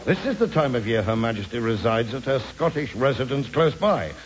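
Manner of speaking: usual speaking voice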